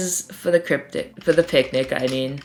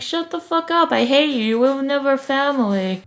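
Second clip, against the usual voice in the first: high-pitched put-on outburst; mock angry rejection; 7 semitones higher than usual. Cryptic rejecting Spello's plea, mock angry. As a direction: snub the pleading in a high voice.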